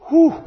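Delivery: loud, very high hoot of amazement; hammed-up high "whew"; amazed whoop. Joke character amazed by the throw.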